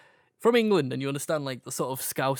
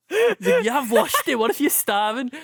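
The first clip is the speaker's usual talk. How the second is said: high, loud, cracking up on the punchline; laughing; 13 semitones higher, 5 dB louder than usual. Real, not acted. Retelling the Scouse lads' punchline, laughing loudly. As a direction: squeal the retold line through laughter.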